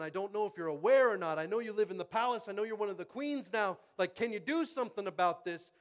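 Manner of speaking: loud, high, fervent preaching; booming pulpit storytelling; preaching at full force. Real, not acted. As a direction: drive the story home with rising fire. Sermon building to full force.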